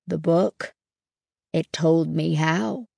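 lightly voiced simple farmhand; a hint of an odd, simple character. A narrator's reading. Stanley explains scarecrows to the narrator, lightly voiced simple farmhand.

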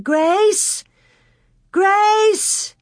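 high, long search call. A narrator's reading. Mary in the shanty doorway, calling out for Grace. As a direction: call the name high and far.

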